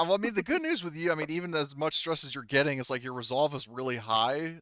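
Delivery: ordinary delivery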